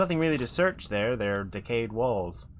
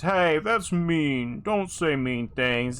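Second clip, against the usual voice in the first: slow dopey giant voice, louder; drawn-out dumb giant whine; dopey cartoon bellow; 4 semitones higher, 4 dB louder, much slower than usual. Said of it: "The giant says".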